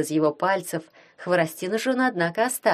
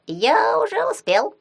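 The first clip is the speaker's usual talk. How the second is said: high squeaky voice; 11 semitones higher, 3 dB louder, slower than usual. Acted. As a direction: squeak it out, much higher. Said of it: "пропищал Халявей".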